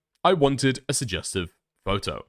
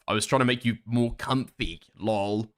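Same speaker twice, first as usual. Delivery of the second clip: normal talking voice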